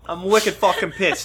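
a hammed-up Boston accent, laughing through it; a mock Boston tough guy, wicked pissed. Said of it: "did you turn into a Boston accent?".

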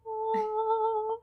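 high, drawn-out "aww"; halo sound effect. Acted. Player voices the halo around Yuki's head, high held note. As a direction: sing out a sustained high aww. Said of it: "It's just like".

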